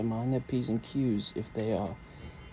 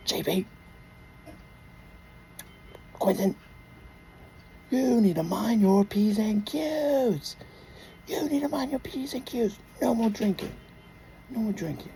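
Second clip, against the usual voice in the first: high, mock-stern mom voice; scolding Mommy; 7 semitones higher than usual. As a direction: imitate a mother telling the boys off, high-pitched. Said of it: "Mommy will come in. She's gonna say".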